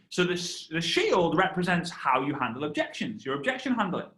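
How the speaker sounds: ordinary talk, nothing marked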